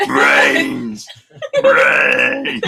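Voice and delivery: loud, hoarse zombie groan; zombie moan. Acted. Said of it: "Would you like to see my zombie impression?".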